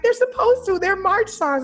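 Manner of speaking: high, loud outraged protest; indignant protest. Retold or imitated. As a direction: push back loudly, pitch way up. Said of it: "I'm like".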